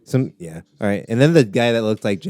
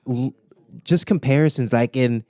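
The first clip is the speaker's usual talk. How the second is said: ordinary delivery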